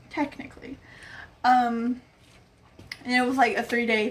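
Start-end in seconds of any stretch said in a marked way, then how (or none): 0.1-0.8 softer, breathy whisper; whispered aside. Real, not acted. Admitting the Princess Peach costume, whispered aside. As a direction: whisper it to yourself.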